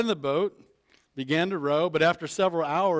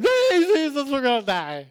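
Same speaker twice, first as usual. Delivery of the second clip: much higher and louder, mock panic; panicked cry; 10 semitones higher, 8 dB louder than usual. Disciples panicking in the storm, calling to Jesus in mock terror. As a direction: yelp it like the boat's going under. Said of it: "now they're in the boat going".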